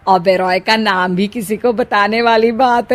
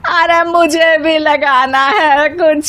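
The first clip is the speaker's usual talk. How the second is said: slow, higher, mock-sulky; comic mother-in-law; 6 semitones higher, slower than usual. Mother-in-law sulkily joins the daughters-in-law, slow and higher. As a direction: give up and join in.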